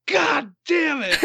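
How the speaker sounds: high, loud, drawn-out cry of despair; hammed-up wail at a book twist; retelling a fan's over-the-top howl of frustration; mock anguished outburst. Retold frustration at character's death.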